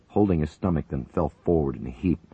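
ordinary delivery, nothing marked

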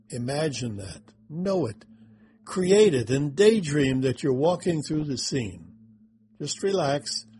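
guided meditation. Acted. Guiding through the scene.

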